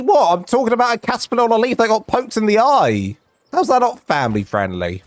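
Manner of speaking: high, fast indignant protest; mock outrage. Real, not acted. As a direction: play up the innocent disbelief. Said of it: "mock outrage at chat".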